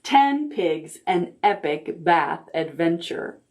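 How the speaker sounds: ordinary delivery, nothing marked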